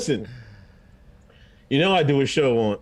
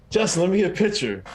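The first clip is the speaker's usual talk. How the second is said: retold troll request; 7 semitones higher, faster than usual. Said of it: "He was like".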